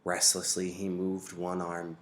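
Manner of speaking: ordinary delivery, nothing marked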